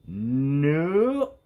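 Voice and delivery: put-on the little girl refusing outright; bratty nope. A narrator's reading. Stephanie refusing each ponytail offer, short stubborn nope.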